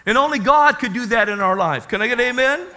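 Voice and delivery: emphatic, exultant sermon delivery; forceful preaching. Real, not acted. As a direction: call the congregation to respond.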